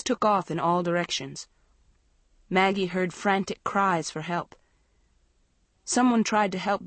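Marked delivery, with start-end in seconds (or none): none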